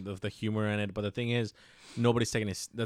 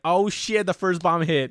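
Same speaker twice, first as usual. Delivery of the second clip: imitating a startled jolt, higher and louder; retold panic, pitched higher and louder; mock alarm; 8 semitones higher, 6 dB louder than usual. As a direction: replay the moment he thought it was a bomb. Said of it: "and I was like".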